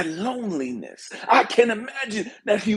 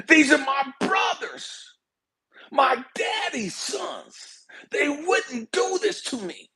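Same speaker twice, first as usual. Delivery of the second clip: desperate disbelief; 6 semitones higher than usual. Acted. Joseph in the ditch insisting on his brothers' loyalty, high desperate disbelief. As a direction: protest in disbelief, stretching the words high.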